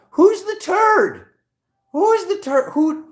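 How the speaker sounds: very high, loud and slow silly squeal; mock squeaky outrage. Reader squealing at a card in mock outrage. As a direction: squeal it like a cartoon.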